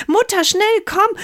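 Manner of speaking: high, urgent call; breathless urgent girl. Acted. Mirjam urges her mother to come, high and urgent. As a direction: hurry her mother out the door.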